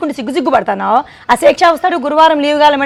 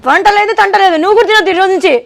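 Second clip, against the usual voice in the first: angry wife; 7 semitones higher, 4 dB louder than usual. Acted. Wife quarrels over cooking.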